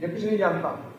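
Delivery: low, soft scoffing aside; muttered dismissal. Retold or imitated. Disciple scoffs under breath at a different saint. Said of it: "तो तुम कहोगे".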